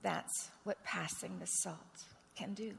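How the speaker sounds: slow, breathy whispered conclusion; hushed and slow, almost to yourself. Acted. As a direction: let it sink in with a whisper. Quiet reflective point after story.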